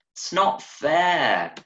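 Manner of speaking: put-on high, slow child's whine; little girl in wellingtons sulking at her brother; sulky little girl. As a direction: drag it out like a pouting kid. Child refusing the race.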